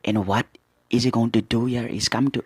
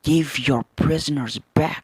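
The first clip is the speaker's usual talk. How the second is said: commanding demand; 6 semitones higher, 3 dB louder than usual. Acted. Soldier demands prisoners back.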